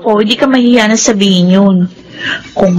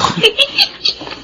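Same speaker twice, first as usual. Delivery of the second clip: soft airy high giggle; a quiet high-pitched laugh of amused delight; breathy giggle; 14 semitones higher, 6 dB quieter than usual. Acted. Yula laughs at the confession.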